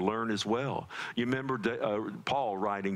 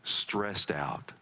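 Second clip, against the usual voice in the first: lower, measured prayer voice, unhurried; 4 semitones lower than usual. Acted. Pastor praying for stressed families before the sermon, low earnest prayer.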